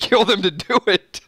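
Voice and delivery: real laughing. Real, not acted. Reader amused by the darn kids line, laughing. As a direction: laugh creeping into the reading. Cracking up reading the article.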